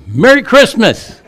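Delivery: cheery shout from the car at the waiting line, laughing; shouted greeting. Acted. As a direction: belt it out gleefully at the waiting line of cars.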